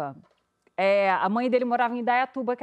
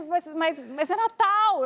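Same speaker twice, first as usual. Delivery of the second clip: pleading higher, almost in tears; near tears plea; 6 semitones higher, slower than usual. Retold or imitated. [name] begs the cop on Christmas, near tears. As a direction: beg like you're about to cry. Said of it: "Eu tava quase chorando".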